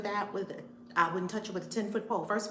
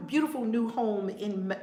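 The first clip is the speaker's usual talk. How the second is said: ordinary talk, nothing marked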